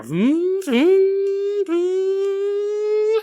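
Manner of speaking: rising high engine vroom, drawn out. Acted. Narrator voices chasing egg cars, high rising vroom. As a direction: imitate engines closing in, climbing high.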